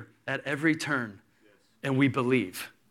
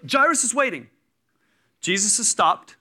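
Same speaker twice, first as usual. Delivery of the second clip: usual speaking voice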